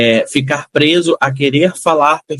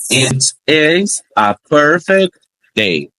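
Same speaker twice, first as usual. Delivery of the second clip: It is a perfect day, each word slowly apart; very slow demo; much slower than usual. Shown as a demonstration. Teacher introducing a new example, very slow. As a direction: spell out the sentence word by word. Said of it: "exemplos que eu vou trazer".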